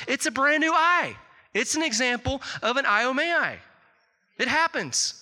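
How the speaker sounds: high, emphatic imitation of astonishment; amazed physician. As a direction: announce it like a doctor stunned by a miracle. Acts physician amazed by healed eye.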